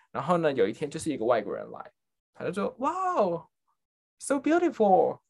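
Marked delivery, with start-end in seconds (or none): 2.8-5.2 higher-pitched, drawn-out tourist awe; admiring tourist. Retold or imitated. Foreign customer admiring the drugstore, imitated awe. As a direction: imitate a wowed customer, stretching it out.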